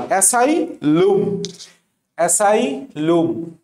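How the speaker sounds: অ্যাসাইলুম drawn out slowly in separated syllables; spelling pronunciation. Shown as a demonstration. Teacher drilling a spelling aid, slow spelling pronunciation. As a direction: say অ্যাসাইলুম slowly, with a long final "loom". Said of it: "কি উচ্চারণ করবা?".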